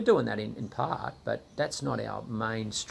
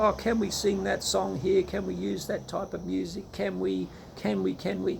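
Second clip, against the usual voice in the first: higher, whiny mock worrier voice; a hint of a fussy busybody; mocking fretful churchgoer; 8 semitones higher than usual. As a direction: parody the nervous nitpicker asking permission.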